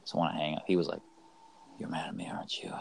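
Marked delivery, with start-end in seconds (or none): 1.8-2.7 low flat whisper-like monotone; deadpan, drained of feeling. Retold or imitated. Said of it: "In a really monotone voice".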